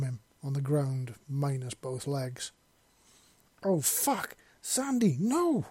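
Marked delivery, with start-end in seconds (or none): 3.6-5.7 rising, high anguished yell. A narrator's reading. Soldier yells to his fallen partner, high and anguished. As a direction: yell her name as she lies wounded. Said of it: "he yelled".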